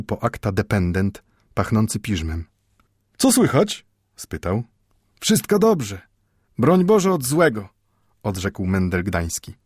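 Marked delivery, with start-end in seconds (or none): 3.2-3.9 put-on high casual greeting from a perfumed young clerk; lightly voiced young clerk greets the bookbinder; a light hint of a clerk. The dependent greets Mendel. 5.2-7.7 put-on higher, guarded polite old man's reply; old Mendel answers the clerk warily.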